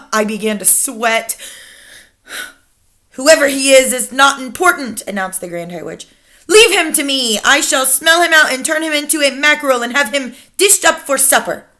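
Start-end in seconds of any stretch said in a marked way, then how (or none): 3.1-11.7 imperious Grand High Witch. Acted. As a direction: lecture your followers with icy authority.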